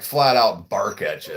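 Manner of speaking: usual speaking voice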